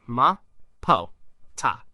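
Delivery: Ma, po, ta as short separate syllables; first-word syllables. Shown as a demonstration. Teacher demonstrating the very first words, crisp syllables. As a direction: sound out Ma, po, ta one at a time. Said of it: "sounded like this".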